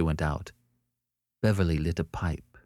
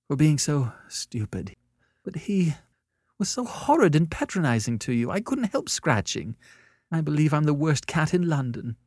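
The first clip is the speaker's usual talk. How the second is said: high, pathetic, moist-eyed voice; tearful apology; 10 semitones higher than usual. A narrator's reading. Annette apologizes to Beverly for scratching, tearfully. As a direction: apologize pitifully just after sobbing. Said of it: "a moist and pathetic smile".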